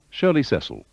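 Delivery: usual speaking voice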